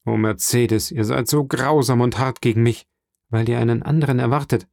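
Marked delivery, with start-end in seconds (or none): none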